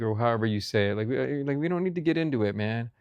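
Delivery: usual speaking voice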